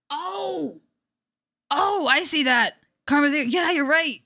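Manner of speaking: excited shout of discovery. Real, not acted. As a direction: burst out noticing.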